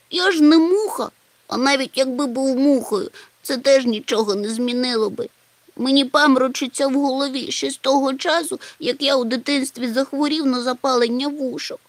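tearful complaint. A narrator's reading. Чмих still tearful.

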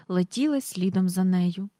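plain reading voice

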